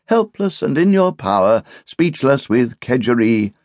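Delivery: pathetic. A narrator's reading. Guest complains of being in his hosts' power, pathetically. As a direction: play the helpless victim, pitched high. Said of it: "said Roger pathetically".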